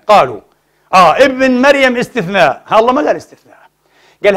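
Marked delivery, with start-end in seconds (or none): none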